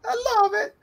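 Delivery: very high squeal through laughter; giggly squeaky mock Italian; cartoon Italian voice. Carrying on the cartoon voice.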